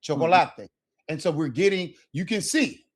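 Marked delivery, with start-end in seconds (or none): 0.0-0.7 chocolate as cho-co-la-te, four clear vowels; Spanish syllable-timed chocolate. Shown as a demonstration. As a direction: sound out every vowel in chocolate. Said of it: "In Espanol".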